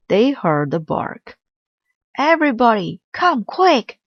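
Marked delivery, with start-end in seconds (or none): 2.2-4.0 high, urgent shout for everyone; boy calling his family over, high and excited. A narrator's reading. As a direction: yell for them to come see. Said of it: "called Arthur".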